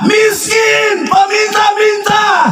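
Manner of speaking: high-pitched preacher's shout; full-force preaching. Real, not acted. Preacher to congregation telling of blind Bartimeus, full-force shout. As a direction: cry out the story to the congregation, stretching the words.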